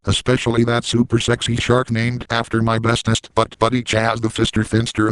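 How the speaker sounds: usual speaking voice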